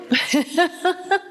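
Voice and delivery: bright laugh at herself; high amused laugh. Real, not acted. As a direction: laugh at your younger self. Laughs at own teen quote.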